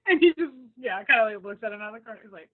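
ordinary talk, nothing marked

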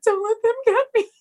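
high, scared pleading voice; panicked plea. Retold or imitated. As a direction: beg like a man who is losing it. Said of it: "And he's like".

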